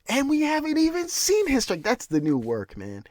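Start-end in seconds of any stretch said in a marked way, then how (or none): 0.1-1.8 high, excited mock commentator hype; squeaky fake commentator gushing; mock announcer. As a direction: ham up the announcer's awe. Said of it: "Anik wants to say".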